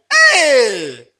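sharp, loud high "Hey!"; loud exclamation. Real, not acted. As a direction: call it out in disbelief. Preacher exclaims at the long surname.

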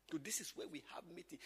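whispered aside. Real, not acted.

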